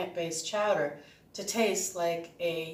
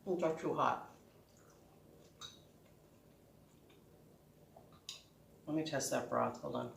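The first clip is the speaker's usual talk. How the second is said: talking around hot soup; words through a hot mouthful; mouth full; 4 semitones lower, 10 dB quieter than usual. Real, not acted. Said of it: "I'm always burning my mouth off".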